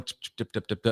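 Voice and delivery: soft voiceless "tip-tip" muttering; imitated mumbling. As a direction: mimic muffled talk through a door. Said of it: "I heard mumbling".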